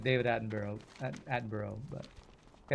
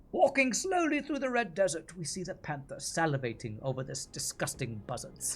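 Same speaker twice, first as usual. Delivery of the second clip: David Attenborough impression. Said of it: "I'll have to work on my David Attenborough".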